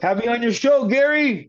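high, loud holler to a friend; playful shout-out. Pretend. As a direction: call his name out loud and high. Playfully calling out to Gary Machuda.

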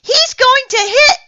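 high, loud alarmed yell, cut short; frantic warning cry. A narrator's reading. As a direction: shout in panic, interrupted mid-sentence. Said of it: "yelled Mister Sunny".

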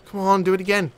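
usual speaking voice

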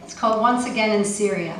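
ordinary talk

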